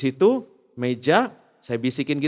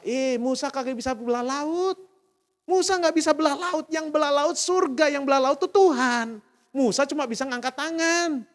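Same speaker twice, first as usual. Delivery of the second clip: full-force preaching; 13 semitones higher than usual. Acted. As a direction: preach it with fire to the congregation.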